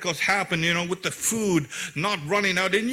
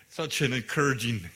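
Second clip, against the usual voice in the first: laughing; 8 semitones lower, 5 dB quieter than usual. Real, not acted. Sarcastic aside about widow's reply.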